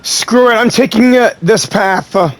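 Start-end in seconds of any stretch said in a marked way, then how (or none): none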